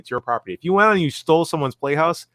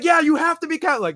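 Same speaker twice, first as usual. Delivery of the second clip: mock priest. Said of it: "It's like".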